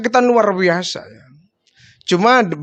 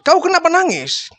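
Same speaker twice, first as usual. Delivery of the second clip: much higher, worried question; concerned question; 9 semitones higher than usual. Acted. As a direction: ask with worry, pitch up. Prophet asks why he cries. (tanya khawatir, jauh lebih tinggi)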